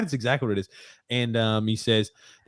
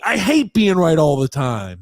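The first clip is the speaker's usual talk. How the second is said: loud slow aggrieved whine; shout-whine it slowly; Grump impression; 5 semitones higher, 5 dB louder than usual. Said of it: "I'm trying to do my Grump impression".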